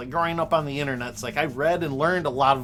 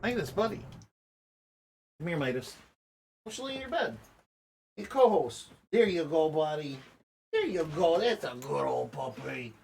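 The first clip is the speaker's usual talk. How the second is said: pet talk; 3 dB quieter than usual. Real, not acted. Talking to his dog.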